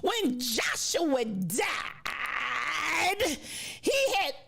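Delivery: very slow nasal preacher, stretched to the end; milk each word like a pulpit proclamation; slow solemn nasal preacher imitation. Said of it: "this is the way he said it too".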